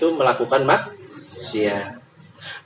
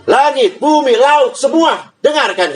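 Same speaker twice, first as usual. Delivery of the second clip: commanding call; 10 semitones higher, slower than usual. Acted. God summoning sky, earth and sea, high and commanding. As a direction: call them all to attention. God calling all creation.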